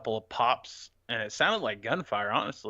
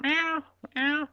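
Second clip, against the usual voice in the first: very high-pitched cat meows; high little meow impression; imitated meowing; 4 dB louder than usual. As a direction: mimic someone meowing like a cat. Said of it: "one dishwasher in particular that meowed".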